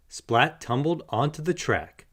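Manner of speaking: ordinary delivery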